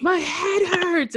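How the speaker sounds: high, slow pained yell; retold scream. Friend clutching his head at the door, pained yell. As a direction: cry out, clutching an aching head. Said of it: "and he starts screaming".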